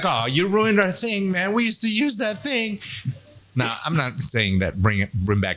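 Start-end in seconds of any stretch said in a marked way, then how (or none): 0.0-2.8 whiny complaint. Retold or imitated. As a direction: complain like they were robbed. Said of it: "They're like".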